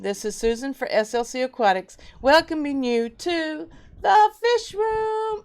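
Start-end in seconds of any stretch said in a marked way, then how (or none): 2.2-5.4 welcome. Acted. Livestream host's sing-song welcome.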